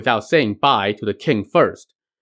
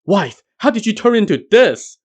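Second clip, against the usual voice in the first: much higher, shocked sputter; 10 semitones higher, 3 dB louder than usual. A narrator's reading. Yellow Robe sputtering at his monkey-faced wife. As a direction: gasp it out in disbelief. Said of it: "he sputtered".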